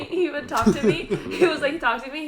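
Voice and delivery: loud, high, through real laughter; laughing while telling. Real, not acted. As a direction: struggle to get it out while cracking up. Laughing while retelling friend's sulk.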